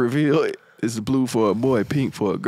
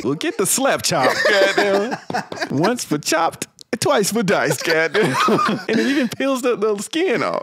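infomercial pitch; 8 semitones higher than usual. Pretend. Mock infomercial pitch, laughing.

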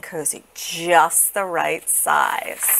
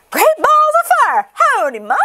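very high, loud gosling; baby goose; 6 dB louder than usual. A narrator's reading. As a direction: holler a high, excited greeting. Newly hatched goose greets Jack.

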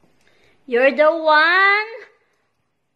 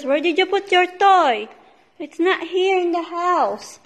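usual speaking voice

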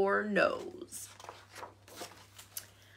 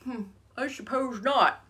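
mildly higher bear voice, muttered; muttering bear; 4 semitones higher, 5 dB quieter than usual. A narrator's reading. Shy bear admitting it to frog, muttering. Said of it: "muttered Bear".

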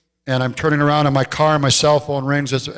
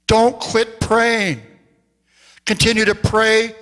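fervent preaching; 6 semitones higher than usual. Acted. Pastor urging the congregation never to quit praying, fervent preaching. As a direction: lift it into full pulpit preaching.

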